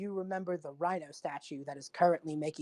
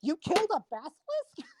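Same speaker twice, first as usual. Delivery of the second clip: Cheapo, surprised; 8 semitones higher, 4 dB louder than usual. Acted. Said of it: "Cheapo's voice goes".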